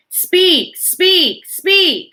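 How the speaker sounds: very high, loud repeated command; fearful building shout. A narrator's reading. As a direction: shout it to rattle eardrums. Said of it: "getting louder and louder ... frightening shout".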